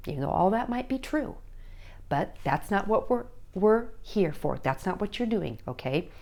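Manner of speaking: normal talking voice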